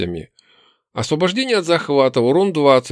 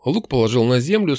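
plain reading voice

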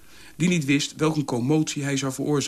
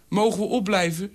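zeurend kind; 8 semitones higher than usual. A narrator's reading. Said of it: "zeurde Ja door".

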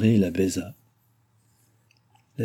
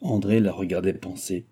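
ordinary delivery

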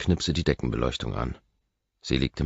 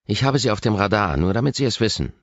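much higher, louder, hostile warning; shrill warning; 7 semitones higher, 6 dB louder than usual. A narrator's reading. Kate warns the visitor she is watching him, shrill. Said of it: "Ihre Stimme klingt schrill".

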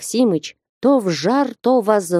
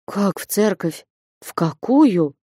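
understated stunned questions; faint dismay, broken into short questions; barely able to speak; slower than usual. A narrator's reading. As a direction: get the words out with difficulty. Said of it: "едва мог промолвить".

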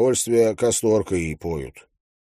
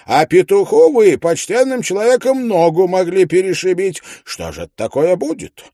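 indignant widow; 7 semitones higher than usual. A narrator's reading. Widow scolds narrator over her rooster, high indignant voice. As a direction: bristle at him like an offended landlady. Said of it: "говорит".

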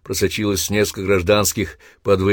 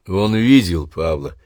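ordinary delivery

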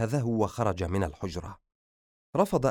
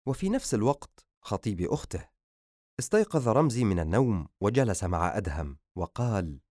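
usual speaking voice

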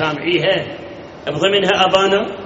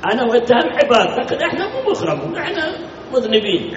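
chuckling through a self-mocking joke; laughing; 6 semitones higher than usual. Real, not acted. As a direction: laugh as you admit we are not honoured. Self-deprecating joke to audience.

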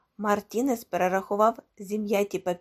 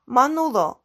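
loud, raised call of a name; 4 semitones higher, 8 dB louder than usual. A narrator's reading. Gomez hails Manulo as he drinks, calling out. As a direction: hail him firmly across the room. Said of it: "гукнув Гомес".

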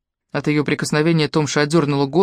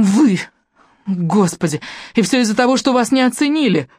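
strained cry; 6 semitones higher than usual. A narrator's reading. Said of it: "крикнула она высоким напряжённым голосом".

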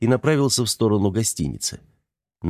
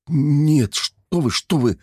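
rattling off in panic; 7 semitones higher, much slower than usual. A narrator's reading. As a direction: gabble it out in fright. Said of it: "затараторил бедолага".

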